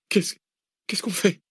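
high frightened stammer. Acted. Cécile asking what now, frightened stammer. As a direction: stumble over the words in fear. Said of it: "balbutia Cécile".

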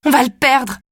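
sudden panicked cry. Acted. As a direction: blurt it out in a panic. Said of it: "Je panique".

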